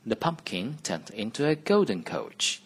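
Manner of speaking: ordinary delivery, nothing marked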